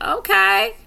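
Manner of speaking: high, drawn-out impressed okay. Retold or imitated. Reacting to gospel songs.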